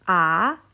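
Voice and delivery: rising "A"; loud "A" climbing like a question; second tone. Shown as a demonstration. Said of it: "nada dua naik".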